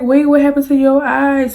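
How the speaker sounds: quick worried confusion; alarmed question. Retold or imitated. Asking her crying drunk friend, startled and confused.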